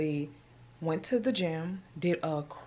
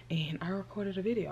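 whispering; 8 dB quieter than usual. Real, not acted. Quiet aside while recording.

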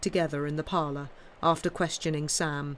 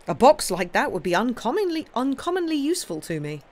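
good humour; 7 semitones higher, 5 dB louder than usual. A narrator's reading. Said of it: "with supreme good humour".

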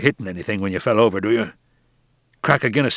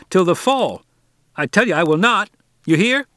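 put-on higher, louder, accusing boy's voice; a proud boy scolding a grown man; fierce harangue; 9 semitones higher, 4 dB louder than usual. A narrator's reading. Boy haranguing the skipper, higher and louder. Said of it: "Harvey harangued fiercely".

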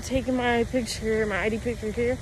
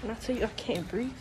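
normal talking voice

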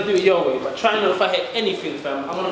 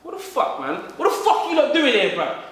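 high, heated yelling; angry; 9 semitones higher than usual. Acted. Bursting in on the crew, he yells angrily. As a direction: storm in furious at the intruders.

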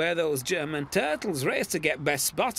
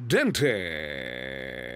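slow drawl; 14 semitones lower than usual. Acted. Nickname drawled slowly.

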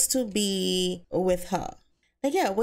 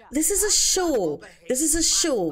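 mock exasperated retort; 7 semitones higher, 3 dB louder than usual. [name] arguing with Axel.

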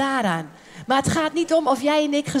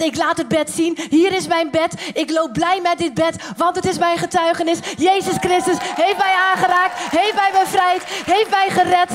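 joyful testimony; 6 semitones higher, 4 dB louder than usual. Acted. Preacher acts joyful healed man.

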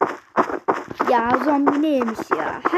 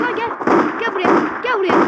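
calling out; 7 semitones higher than usual. Real, not acted. Calling an enemy in game.

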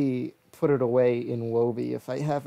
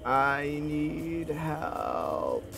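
very slow, stretched whine; drawn-out plea; 2 semitones higher, much slower than usual. Real, not acted. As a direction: drag the words out begging. Pleading while attacked.

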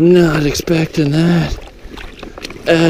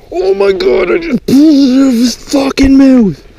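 much higher, louder shout of disbelief; excited shout; 11 semitones higher, 5 dB louder than usual. Real, not acted. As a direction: yell it out, stunned and thrilled. Fish escapes off the hook.

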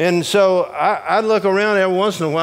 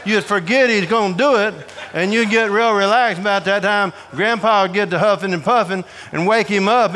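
usual speaking voice

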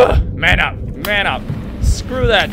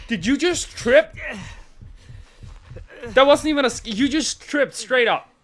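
ordinary delivery

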